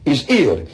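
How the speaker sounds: "He's healed" with no H: "'E's 'ealed"; dropped H's. Retold or imitated. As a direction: drop the H in "healed". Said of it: "he didn't pronounce H's".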